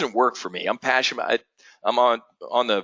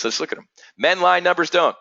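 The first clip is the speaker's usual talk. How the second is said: ordinary delivery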